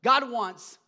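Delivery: rising sermon fervor, punched words; full-force preaching. Real, not acted. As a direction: drive it home to the congregation.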